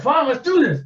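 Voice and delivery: high, loud challenge; ready to fight. Retold or imitated. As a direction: accept the brawl, loud and high. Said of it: "the guy's like".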